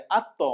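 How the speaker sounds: আত্ম with a heavy t bounced into a short echo; আত্ম ending in a quick popped release; qalqalah echo. Shown as a demonstration. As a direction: say আত্ম as "at-to", the t rebounding. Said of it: "ত সাকিনে কলকলা".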